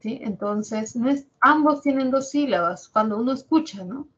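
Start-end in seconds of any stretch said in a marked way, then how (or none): none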